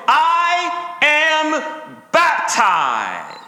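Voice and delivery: high, drawn-out declaration, word by word; loud defiant cry. Acted. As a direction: cry it out slowly at the tempter. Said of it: "cry out in a fairly loud voice".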